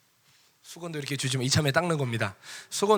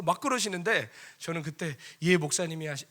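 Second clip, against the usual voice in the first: normal talking voice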